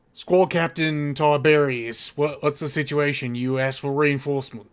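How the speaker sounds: loud, barking soldier reporting to his captain; Ultramarine soldier voice. Acted. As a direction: call it out like a marine on the battlefield. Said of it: "he goes".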